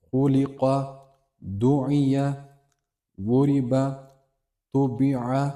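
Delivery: each word set apart and read out carefully as a model; Arabic word reading. Shown as a demonstration. Teacher reads the word list for students, careful recitation.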